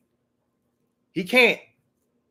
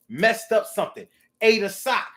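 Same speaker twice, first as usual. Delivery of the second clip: ordinary talk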